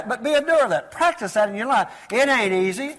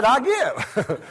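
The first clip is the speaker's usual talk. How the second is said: laughing surrender; 4 semitones higher than usual. Retold or imitated. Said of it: "I said".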